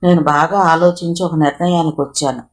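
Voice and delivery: ordinary delivery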